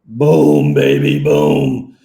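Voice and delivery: low, slow, loud cheer; triumphant shout. Real, not acted. Celebrating the link working.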